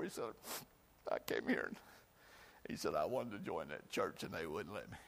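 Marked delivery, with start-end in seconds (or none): 1.1-5.0 hushed sad reply. Acted. Rejected man answers Jesus.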